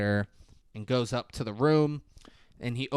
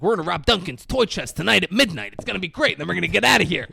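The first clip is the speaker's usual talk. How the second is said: high, loud, gleeful blurting; excited oversharing; 6 semitones higher, 6 dB louder, faster than usual. Retold or imitated. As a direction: blurt out the whole plan, acting thrilled. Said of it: "Marv just overshares".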